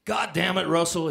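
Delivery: imitating a yell from the doorway. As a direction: call it out across the yard. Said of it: "he yells from the front door".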